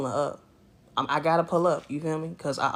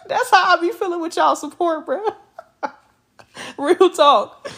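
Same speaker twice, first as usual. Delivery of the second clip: high, loud, laughing through the words; 12 semitones higher, 6 dB louder than usual. Real, not acted. Streamer laughing with her viewers about their support. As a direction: giggle it out delightedly.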